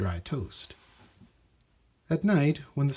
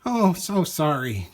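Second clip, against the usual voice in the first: flustered shopkeeper; 5 semitones higher, 5 dB louder than usual. Acted. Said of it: "cried the shopkeeper".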